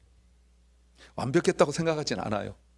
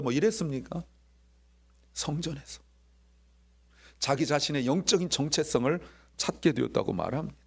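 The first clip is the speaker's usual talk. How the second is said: normal talking voice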